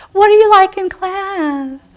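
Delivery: high-pitched, slow mock cooing; nosy relative. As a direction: imitate an overly interested relative, stretching the words. Imitating relatives asking about school.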